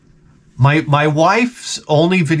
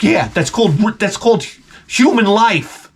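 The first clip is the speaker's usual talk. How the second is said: sarcastic retort; 7 semitones higher than usual. Retold or imitated. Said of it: "I'm like".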